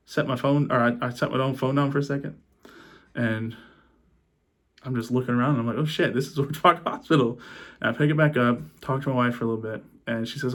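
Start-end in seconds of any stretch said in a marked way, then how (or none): 5.8-7.4 laughing. Retold or imitated. Realizing where he is.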